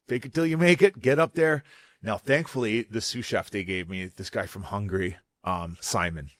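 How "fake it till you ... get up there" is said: mildly loud, higher-pitched self-motivation; pep talk. Retold or imitated. Chef telling himself to face the dinner, raised pep talk. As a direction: psych yourself up out loud.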